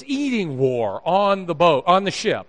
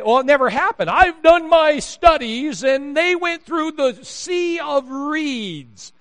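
high, loud, drawn-out know-it-all parody; mock scholar; 10 semitones higher, 5 dB louder, slower than usual. Theologian who did his studies, mocked in high voice. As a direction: stretch it out high, hammed-up and self-important. Mocks a sceptical theologian's claim.

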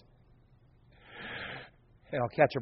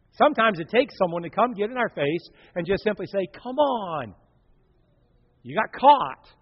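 loud, high confronting preacher; preaching at full force; 6 semitones higher, 4 dB louder than usual. Acted. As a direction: get in their face and preach.

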